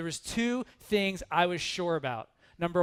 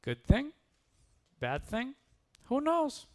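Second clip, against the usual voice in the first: higher, questioning sage with slight put-on accent; lightly voiced wise farmer; 8 semitones higher than usual. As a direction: weigh each option with a rising lilt, pausing between.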